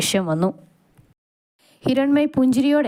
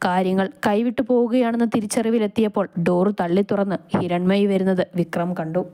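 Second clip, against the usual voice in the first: ordinary delivery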